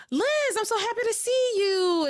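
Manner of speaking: high, loud, gushing hello; delighted greeting. Retold or imitated. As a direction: gasp then gush over her. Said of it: "And he's like".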